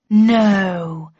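mustering all the shocked concern she can fake; overplayed mock concern; feigned surprise. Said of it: "with as much surprise and concern in my voice as I could muster".

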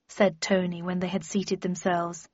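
ordinary delivery

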